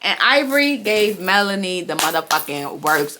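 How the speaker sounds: ordinary talk, nothing marked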